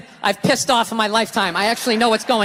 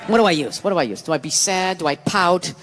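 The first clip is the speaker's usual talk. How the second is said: mock scheming girlfriend; 7 semitones lower than usual. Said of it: "manipulation mode".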